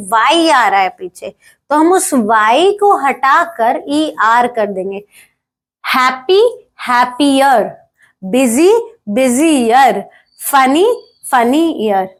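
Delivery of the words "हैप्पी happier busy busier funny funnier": slow demo. Shown as a demonstration. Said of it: "clear?".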